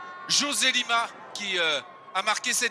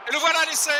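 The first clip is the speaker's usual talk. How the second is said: louder burst on the try; excited try call; 5 semitones higher, 6 dB louder, faster than usual. Real, not acted. Try scored, live call. (annonce d'essai enthousiaste)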